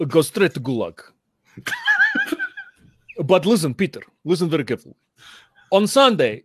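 mock Slovenian accent, hammed-up philosopher lecturing a rival; playful Slovenian-accented impression taunting a colleague; Slavoj Žižek's Slovenian accent. Philosopher mocks Peter Singer in a joking Slovenian accent. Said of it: "And Slavoj Žižek is like".

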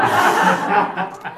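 a high burst of sheepish laughter; embarrassed laugh. Real, not acted. Admitting to the group she never read it aloud, laughing. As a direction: laugh it off, caught out. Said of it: "I promise I read this".

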